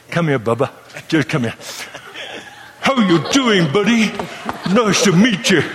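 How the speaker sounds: laughing, back-slapping warm hello; warm southern welcome. Retold or imitated. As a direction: play a big-hearted southerner pulling you in. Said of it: "when you go down to the southern culture".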